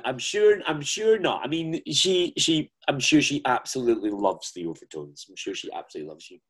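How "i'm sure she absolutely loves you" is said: softer and quicker, a sly throwaway; teasing aside. Acted. Host teasing the guest about the Queen, a soft fast aside. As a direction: toss it off under your breath.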